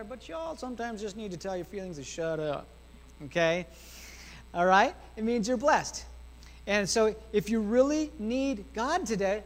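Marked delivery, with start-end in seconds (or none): none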